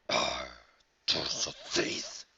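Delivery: deep, low and hissed; dragon voice. Acted. Dragon spotting the thief, low hissed growl. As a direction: rumble it out like a huge dragon. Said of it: "he shouted".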